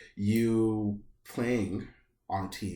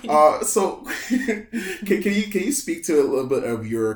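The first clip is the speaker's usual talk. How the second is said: loud, higher, through leftover laughter; laughing; 9 semitones higher, 10 dB louder than usual. Real, not acted. Interviewer returns to questions after a joke, still laughing. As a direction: try to ask through the giggles.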